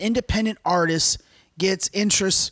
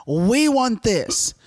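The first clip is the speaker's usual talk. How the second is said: bossy record label; 8 semitones higher, slower than usual. Retold or imitated. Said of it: "they're like".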